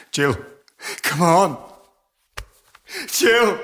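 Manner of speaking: loud, high pleading call; desperate calling. Acted. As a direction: beg her to wake up. Begging the dying woman to respond.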